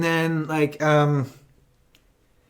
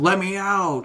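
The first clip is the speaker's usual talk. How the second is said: slightly raised, half-hearted villain plea; mock trapped plea; 4 semitones higher, slower than usual. Retelling the locked-up villain asking release, mock plea. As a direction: lightly imitate a prisoner calling out.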